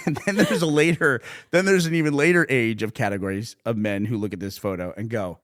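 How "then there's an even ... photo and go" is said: ordinary delivery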